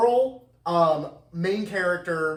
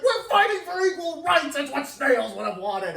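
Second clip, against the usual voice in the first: mock outraged shout; 9 semitones higher than usual. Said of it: "she's like".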